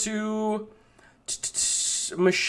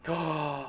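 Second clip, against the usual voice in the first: sharp gasp; 4 semitones lower, 10 dB quieter than usual. Real, not acted. Discovers the secret place.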